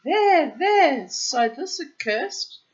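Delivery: high, loud alarmed cry from the mother. Acted. Frightened mother crying out to her son, loud and high. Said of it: "she cried".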